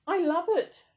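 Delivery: high, loud gush of delight with gasps; delighted squeal. Real, not acted. Opening her new blanket, gushing with real delight. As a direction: swoon over the new blanket. Unwrapping a rainbow fleecy blanket.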